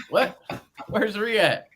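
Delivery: high, incredulous back-and-forth; joking, puzzled calling out; mock bafflement. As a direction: play up the confusion, high pitched. Acting out mistaken name joke.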